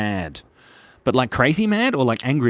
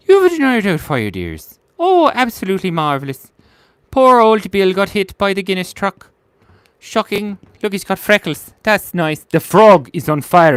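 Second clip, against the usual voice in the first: put-on high, lilting Welsh old lady; chatty Welsh granny voice; Welsh accent; 6 semitones higher, 6 dB louder than usual. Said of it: "I sound like Uva de Nile Doubtfire".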